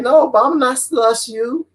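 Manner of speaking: high put-on believer voice; mocking naive believer. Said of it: "it's like".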